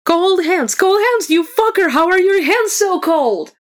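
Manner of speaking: very high squealed protest; piercing shriek. A narrator's reading. Bard as cold hands slide under his tunic, shrill outraged squealing. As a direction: shriek high enough to make listeners wince. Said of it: "He hits a note that makes Aiden wince".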